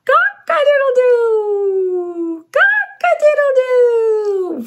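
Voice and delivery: high, slow, drawn-out crowing; rooster crow. Acted. Crowing with the child like a rooster. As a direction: crow long and proud like a rooster. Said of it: "Let's crow together like a rooster".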